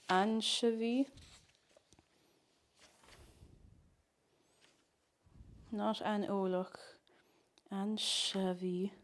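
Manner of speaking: anchovy drawn out slowly and softly; slow demo. Shown as a demonstration. Teaching viewers anchovy, very slow and soft. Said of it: "slow modeled pronunciation".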